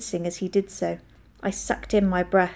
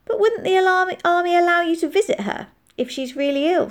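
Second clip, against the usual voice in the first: put-on high child's voice, puzzled; questioning child; 10 semitones higher, 3 dB louder than usual. A narrator's reading. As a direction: question the soldier's story as a child.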